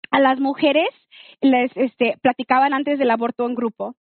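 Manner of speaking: ordinary talk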